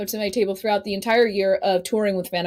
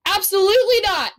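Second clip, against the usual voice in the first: emphatic refusal; 10 semitones higher than usual. Retold or imitated. Retold refusal to meet them.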